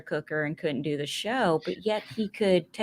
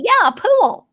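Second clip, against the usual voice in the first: loud, high Southern pool with an added syllable; Southern accent; 13 semitones higher, 9 dB louder than usual. Retold or imitated. Southerner confirming pool to the northerner, emphatic Southern accent. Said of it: "I add a syllable to everything".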